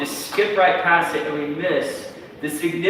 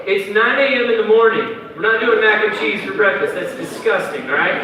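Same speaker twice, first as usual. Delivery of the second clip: ordinary talk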